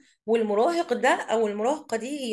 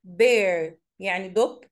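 ordinary delivery

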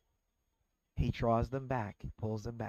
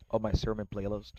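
ordinary talk

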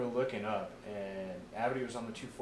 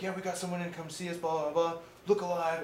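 mock announcement; 7 semitones higher than usual. Troops told someone is coming to see them, mock announcement. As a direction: rattle it off as a raised-voice heads-up.

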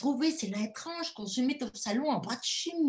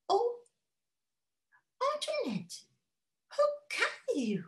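high, pained English lady; English governess; slower than usual. Acted. Governess reproaching Antoinette, high and tearful. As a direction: plead with her, near tears.

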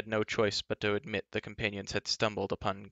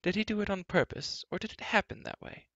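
curious prince; 10 semitones higher than usual. A narrator's reading. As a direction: wonder aloud, high-pitched, as the prince. Curious prince asks about the beard.

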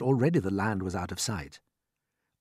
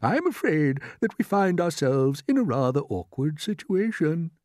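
higher, louder character voice; a worried old grasshopper, pitched up; old green grasshopper; 8 semitones higher, 4 dB louder than usual. Acted. Grasshopper tells the others they are in trouble, apprehensive.

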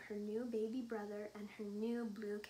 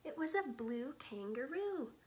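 ordinary delivery, nothing marked

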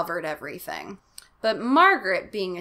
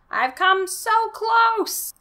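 mad scientist; 13 semitones higher, 6 dB louder, much slower than usual. Acted. Mad scientist dad, drawn out.

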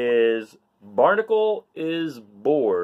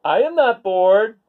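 happy exclaim; 9 semitones higher, 7 dB louder than usual. Acted. Barnacle finally happy.

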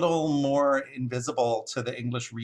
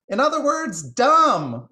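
very high, loud mockery; jeering jay; 13 semitones higher, 9 dB louder than usual. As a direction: crow the insult gleefully, high and loud. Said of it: "laughed the jay".

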